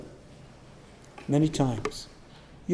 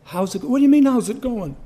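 incredulous retort; 10 semitones higher, 9 dB louder than usual. Retold or imitated. Drunk retorts to pastor's question.

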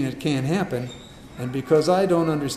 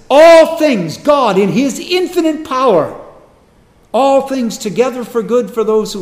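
loud, rising sermon climax, hammering each phrase; full-force preaching; 5 semitones higher, 10 dB louder than usual. Acted. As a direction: preach it out to the back row, full voice.

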